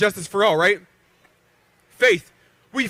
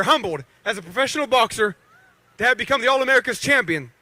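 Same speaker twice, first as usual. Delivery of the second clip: ordinary talk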